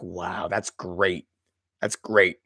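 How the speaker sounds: low, quiet, slow sarcastic wow; deadpan sarcasm. Retold or imitated. Mock-unimpressed reaction to the film twist. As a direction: say it flatly, not impressed at all.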